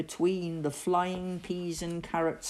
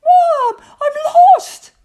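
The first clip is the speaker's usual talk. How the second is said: put-on very high, loud child's cry; panicked boyish holler; wailing call; 17 dB louder than usual. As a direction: wail for mum from far away. Lost Victor calls back to Mum.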